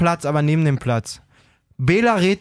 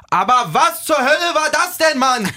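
exasperated outburst; 9 semitones higher than usual. Real, not acted. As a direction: blow up in disbelief. Rant at the commentator.

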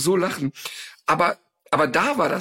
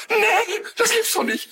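high-pitched squealed retelling of astonishment; imitated high squeal of disbelief; mock disbelief; slower than usual. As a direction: squeal it like a woman caught off guard, acted out. Acts out woman's startled reaction.

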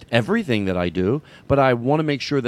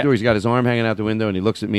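normal talking voice

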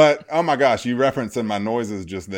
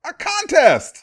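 hyped reveal; 7 dB louder, slower than usual. Acted. Building hype for announcement.

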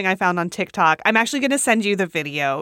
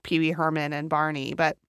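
ordinary talk

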